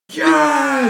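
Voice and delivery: high, long excited shout; cheering fans. Acted. Imitating fans hearing of a banned song, loud cheering. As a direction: roar it like a whole crowd. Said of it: "all the fans are like".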